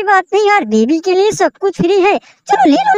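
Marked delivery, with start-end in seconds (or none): 2.2-3.0 very high, slow squeaky toy voice; Superman toy voice. Pretend. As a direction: squeak the price like the little Superman shopkeeper. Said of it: "Superman toy answers the buyer". (Superman खिलौने की बहुत ऊँची पतली आवाज़)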